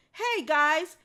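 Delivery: loud call across the cages; barked call. Acted. Dog calls to the caged dogs, barking. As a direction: call out to the others. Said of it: "she barked to the other dogs".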